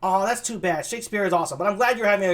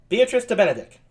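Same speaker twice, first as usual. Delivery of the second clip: usual speaking voice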